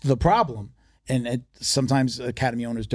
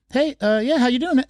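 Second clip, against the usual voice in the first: much higher, imitated hello to a stranger; hesitant greeting; 12 semitones higher than usual. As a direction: mimic a high-pitched, unsure welcome. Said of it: "I'm a stranger walking in".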